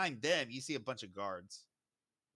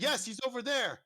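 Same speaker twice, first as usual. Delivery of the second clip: high, loud and emphatic; insistent accusing shout; 11 semitones higher, 5 dB louder than usual. Acted. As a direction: call it out to the guard, pitched way up. Owner points out culprit to guard.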